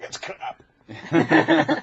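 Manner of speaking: high, loud mock Scottish scoff; mock Scot. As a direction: dismiss it in Scottish. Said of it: "they'll come back and be like".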